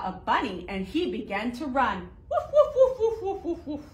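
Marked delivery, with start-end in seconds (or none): 2.3-3.8 much higher, louder yapping barks through a laugh; playful high puppy woofs. Acted. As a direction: yap like a little puppy. Puppy in counting rhyme barks.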